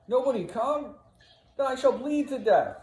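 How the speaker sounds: high, loud dying plea; yelling in despair. Acted. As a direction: wail for someone to come. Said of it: "Roderigo off in the distance yelling".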